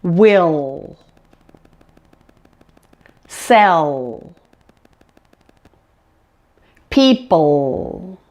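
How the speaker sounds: WILL, SELL, PEOPLE slowly, each final L held long and dark. Shown as a demonstration. Teacher leading repeat-after-me, long dark L in WILL, SELL, PEOPLE. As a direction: model each word alone, lingering on the L of WILL, SELL, PEOPLE. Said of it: "pronounce those after me".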